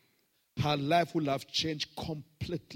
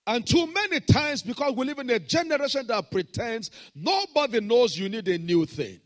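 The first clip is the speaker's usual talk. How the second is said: loud, higher preaching; 10 semitones higher, 10 dB louder than usual. Acted. Pastor preaching to the congregation, loud and high. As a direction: keep the fire going.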